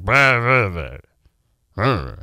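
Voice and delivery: low macho mumble; mock mumble, dropping low and trailing away; mumbling. Shown as a demonstration. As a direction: slur it into a deep, indistinct rumble. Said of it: "Maybe I should start mumbling".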